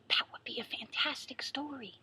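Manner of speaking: gasp, then softly whisper in wonder; awed whisper. Acted. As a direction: breathe it out like a delicious secret. Excited gasp at story idea.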